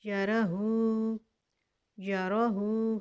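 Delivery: यरहू slowly, with the long u; recited word. Shown as a demonstration. Teacher recites the joined form slowly. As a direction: draw out यरहू. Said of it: "हा के ऊपर उल्टा पेश है".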